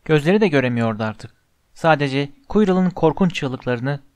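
plain reading voice

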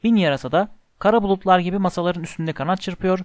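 ordinary delivery, nothing marked

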